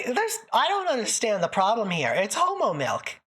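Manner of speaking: usual speaking voice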